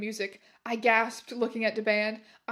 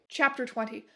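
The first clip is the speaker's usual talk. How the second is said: plain reading voice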